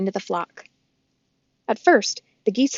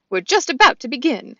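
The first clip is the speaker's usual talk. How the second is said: goose voice; 4 semitones higher, 7 dB louder than usual. Acted. Loudwing speaks.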